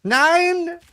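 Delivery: loud high hammed-up German shout; mock German yell. Playing the yelling German, mock German shout. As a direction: scream "Nein!" like a cartoon German. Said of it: "German man yelling".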